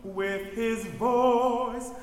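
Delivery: "voice" with a long buzzing V at the start; strong V on voice. Shown as a demonstration. Director showing altos a stronger V on voice. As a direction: lean into the V of "voice". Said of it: "more V on voice though".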